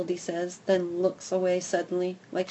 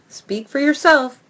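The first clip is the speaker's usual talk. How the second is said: louder, higher growly retort; mild growl; 7 semitones higher, 9 dB louder than usual. Acted. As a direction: snap back with a growl. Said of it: "with a growl".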